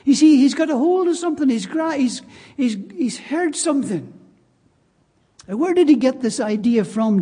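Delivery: usual speaking voice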